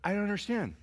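confused father. Retold or imitated. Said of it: "I said".

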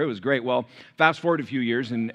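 ordinary talk, nothing marked

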